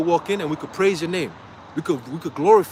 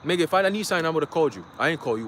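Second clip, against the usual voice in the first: ordinary talk, nothing marked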